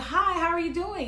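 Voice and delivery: high-pitched friendly hello, re-enacted; greeting a child. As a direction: pitch it up to greet a shy little boy. Greets shy little boy in cart.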